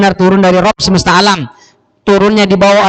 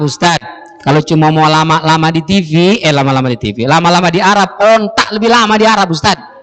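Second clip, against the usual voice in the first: usual speaking voice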